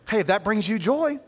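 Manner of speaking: ordinary talk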